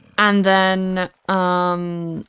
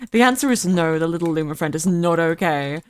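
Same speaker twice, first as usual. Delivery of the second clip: ordinary delivery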